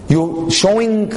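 pitched high and drawn out slowly; slow emphatic build. Real, not acted. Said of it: "stressed slow phrase".

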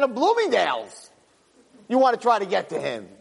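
high-pitched, scornful mock tirade; vengeful God. God telling a girl she can't talk to Him, mock vengeful. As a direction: play an indignant deity putting a human down. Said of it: "so mean and evil and vengeful".

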